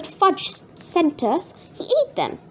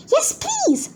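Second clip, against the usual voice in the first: put-on high eager old lady; lightly voiced grandma. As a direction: chirp it like a delighted granny. Grandma accepting chocolate.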